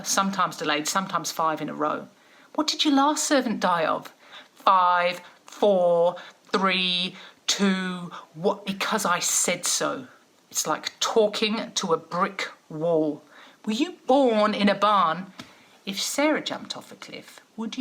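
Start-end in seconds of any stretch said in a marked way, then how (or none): 2.5-4.1 stern mum scolding. Retold or imitated. Mum scolding kids with cliché. 4.5-10.1 countdown warning. Retold or imitated. Mum counting down at kids. 13.6-15.3 scolding mum. Retold or imitated. Mum scolding kids with cliché.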